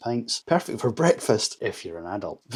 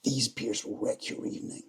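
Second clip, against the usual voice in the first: soft whispered warning; ominous whisper; 7 dB quieter than usual. Pretend. Reviewer warning drinkers off strong beers, hushed whisper. Mock warning about triple IPAs.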